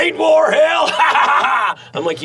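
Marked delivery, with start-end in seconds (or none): 0.0-1.9 high, loud shout breaking into a cackle; imitation of a gunner's shouted laugh; yelling movie-scene impression. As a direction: yell it high, then laugh wildly. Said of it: "he's fucking yelling".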